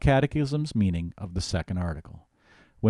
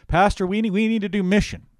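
much higher, louder eager churchgoer; mimicking a parishioner; 11 semitones higher, 5 dB louder than usual. Pastor imitating people who ask him for mission, higher. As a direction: play the pushy congregant begging.

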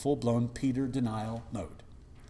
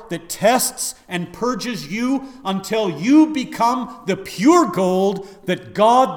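preaching at full force; 8 semitones higher, 12 dB louder than usual. Acted. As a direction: preach it like a revival climax. Preaching build to climax.

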